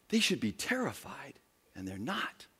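hushed unvoiced whisper; awed whisper. Onlookers marvelling at fearless believers, whispered. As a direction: marvel under your breath.

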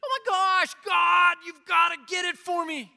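high, loud frantic shout at God; hammed-up panic, begging God to fix it now; a pastor mocks a panicked believer begging God; mock panicked plea. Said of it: "and we're like".

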